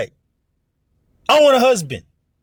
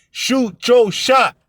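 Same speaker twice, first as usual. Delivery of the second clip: high, slow, punched-out phrase; emphatic preacher's command; emphatic urging; 7 semitones higher, much slower than usual. Real, not acted. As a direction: drive the words home slowly. Urging sisters on comment board.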